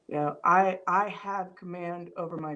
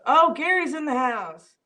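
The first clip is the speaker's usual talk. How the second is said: excited greeting; 7 semitones higher, 8 dB louder than usual. Real, not acted. Greets a viewer arriving live.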